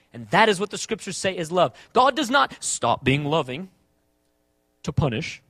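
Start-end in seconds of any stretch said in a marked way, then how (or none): none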